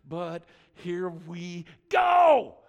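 giving in at the top of the hill; resigned shout. Retold or imitated. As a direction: brace yourself and yell it as the car tips over. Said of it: "we're going".

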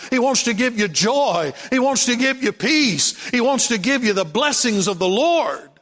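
fervent, driving sermon cadence; impassioned preaching. Real, not acted. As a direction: build each line toward a rousing amen. Preaching, building repeated promises.

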